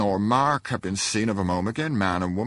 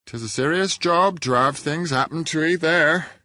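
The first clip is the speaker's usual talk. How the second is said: woman's voice; 10 semitones higher, 5 dB louder than usual. A narrator's reading. A woman behind speaks up.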